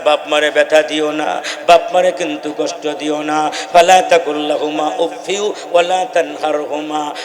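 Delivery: ordinary talk, nothing marked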